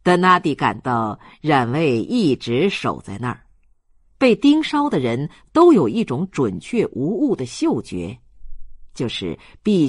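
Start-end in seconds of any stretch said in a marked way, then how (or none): none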